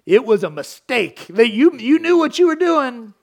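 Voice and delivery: mock parent rebuke growing stronger; parent correcting. Preacher voices a parent correcting a child, building rebuke. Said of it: "You say".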